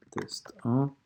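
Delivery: unvoiced whisper, rising at the end; whispered while typing. Real, not acted. Teacher retyping the test title, whispered. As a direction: breathe the words as you key them.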